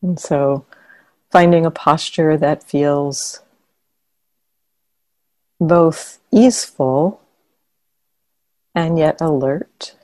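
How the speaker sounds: calm meditation guidance with long pauses between phrases; guided meditation. Real, not acted. Teacher guiding meditators to soften and relax the body, guided meditation. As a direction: guide them gently into relaxation, leaving long silences.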